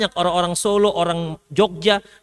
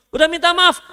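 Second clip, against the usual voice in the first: normal talking voice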